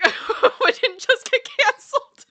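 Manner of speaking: high, loud, slow laughing words. Real, not acted. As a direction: squeal it through laughter. Laughing still.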